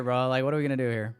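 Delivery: ordinary talk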